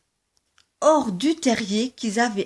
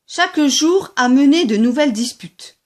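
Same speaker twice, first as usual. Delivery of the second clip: plain reading voice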